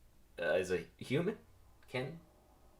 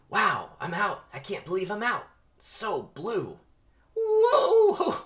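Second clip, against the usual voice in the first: high, loud thrilled cry; excited young bee; 10 semitones higher, 9 dB louder, slower than usual. Acted. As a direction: burst out with joy at flying. Barry flying free, thrilled.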